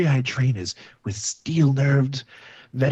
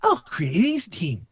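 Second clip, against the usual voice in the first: excited exclaim; 8 semitones higher than usual. Acted. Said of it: "he exclaimed".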